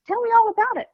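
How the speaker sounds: mother hearing daughter's big news, delighted; excited mom. Retold or imitated. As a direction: gush like a thrilled parent. Mother's excited reaction retold.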